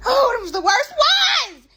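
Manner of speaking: high shout on worst; exclaiming. Real, not acted. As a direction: cry out how bad they were.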